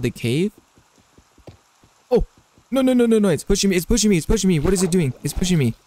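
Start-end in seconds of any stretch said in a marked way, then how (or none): 2.1-5.7 high, rapid panicked repeats. Real, not acted. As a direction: blurt it out in rising alarm as you're shoved. Pushed by something in game.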